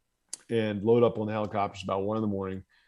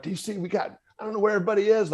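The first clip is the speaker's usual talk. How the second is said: freaking out; 9 semitones higher, faster than usual. Retold or imitated. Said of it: "I start freaking out again".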